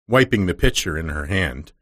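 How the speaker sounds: usual speaking voice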